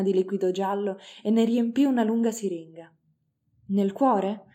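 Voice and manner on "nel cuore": ordinary delivery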